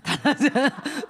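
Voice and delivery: loud bright laugh out loud; a short real laugh; burst of laughter. Real, not acted. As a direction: laugh at the memory. Laughs at husband's thought.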